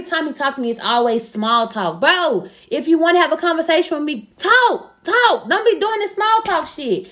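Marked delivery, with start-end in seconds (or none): 4.4-7.1 high, emphatic one-word demands, then scolding; exasperated demand. Retold or imitated. Telling him to have a real conversation, exasperated. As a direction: demand it as if he were here, pitched high. Addresses absent man in exasperation.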